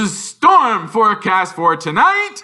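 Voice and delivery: high, loud, slow gloating then a cackle; gleeful Vernon. A narrator's reading. Uncle Vernon to family, gleeful and loud. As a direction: relish the storm news and laugh. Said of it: "said Uncle Vernon gleefully".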